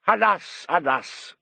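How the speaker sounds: put-on Italian-accented lament, high then falling; marked Italian accent. A narrator's reading. Italian maestro despairing of the opera, marked Italian accent. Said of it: "spoke with a marked Italian accent".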